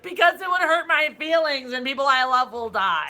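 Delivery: loud, high, drawn-out whine; hammed-up plea, stretching the words; mock a childish, wounded protest; mock whining. Mocks heroes' weak emotional argument.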